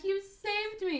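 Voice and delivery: very high put-on leading lady; hammed-up grateful damsel voice; swooning heroine. Rescued woman to her saviour, mock swooning.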